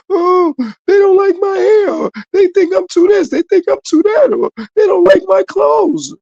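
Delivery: high, loud, drawn-out mock whine; overemotional whining. Mocking people upset over others' opinions of their looks, whiny and overemotional. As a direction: wail it like someone all caught up in hurt feelings. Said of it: "Why you so emotional".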